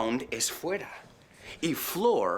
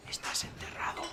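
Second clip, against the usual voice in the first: whisper; 11 dB quieter, faster than usual. Real, not acted. Joke aside about burial.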